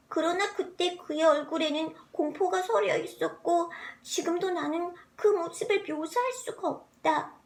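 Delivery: ordinary delivery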